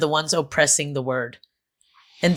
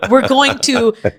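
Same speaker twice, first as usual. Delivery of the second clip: mock doctor; 3 semitones higher, 4 dB louder than usual. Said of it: "It sounds so medical".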